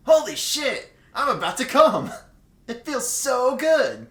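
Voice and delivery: loud, high, slow overacted cry; hammed-up climax. Friend acting the character, loud overacted climax. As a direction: ham it up like a bad porn line.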